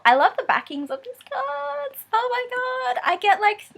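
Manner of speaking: high, gushing delight; excited. Real, not acted. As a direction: gush over it breathlessly. Thrilled by card backs.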